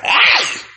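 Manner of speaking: sudden high whoop of a rowdy uncle; short high celebratory shout; high party yell. Pretend. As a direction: let out a high excited yelp. Uncle [name] yelling.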